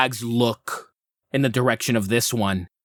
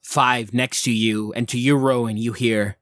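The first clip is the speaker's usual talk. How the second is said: usual speaking voice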